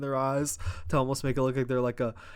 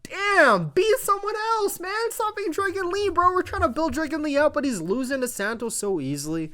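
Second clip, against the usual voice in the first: much higher, whiny outburst; exasperated high rant; 14 semitones higher than usual. Real, not acted. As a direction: plead in frustration.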